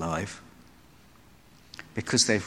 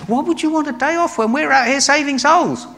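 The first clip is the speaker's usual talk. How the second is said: much higher, louder scolding; exasperated Paul; 7 dB louder than usual. Retold or imitated. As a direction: scold him for slacking. Driven Paul scolds Mark.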